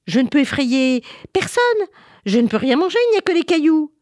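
high, loud monster complaint; frustrated monster. Acted. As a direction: whine indignantly as the thwarted monster.

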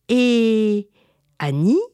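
usual speaking voice

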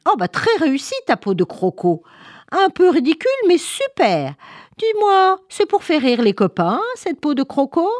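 high, lively teasing voice; mocking animal character. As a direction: poke fun with high, exaggerated admiration. Animal mocks Albina's crocodile skin. (voix aiguë, faussement admirative)

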